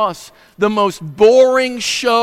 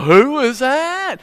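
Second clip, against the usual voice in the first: mock-puzzled, drawn out and higher; puzzled crowd; 6 semitones higher, slower than usual. Preacher imitating a puzzled congregation, drawn out. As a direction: play the whole room scratching its head. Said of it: "everybody'd go".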